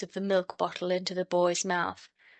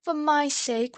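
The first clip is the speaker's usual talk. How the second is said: pleading; 8 semitones higher than usual. A narrator's reading. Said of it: "repeated Bobby".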